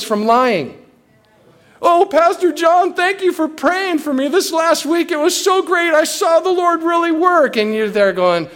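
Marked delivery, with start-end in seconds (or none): 1.8-7.6 high, gushing grateful churchgoer; gushing thanks. Acted. Church member thanking the pastor for praying, gushing in a high voice. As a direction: mimic an overjoyed congregant, pitched way up.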